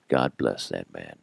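low, soft murmur; deep, hushed aside; admiring onlooker. Retold or imitated. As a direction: imitate a bystander's quiet approval under the breath. Imitates admiring onlooker in stores.

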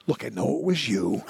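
ordinary delivery

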